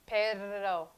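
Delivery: ordinary talk, nothing marked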